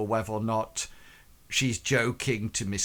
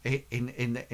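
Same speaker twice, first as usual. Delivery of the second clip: ordinary delivery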